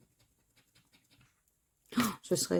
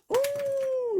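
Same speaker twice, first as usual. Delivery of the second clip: delighted high oh at a winning ticket; a sudden squeal of joy; excited oh. Real, not acted. Scratching a ticket, delighted squeal.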